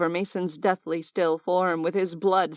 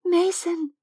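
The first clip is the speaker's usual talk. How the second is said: plain reading voice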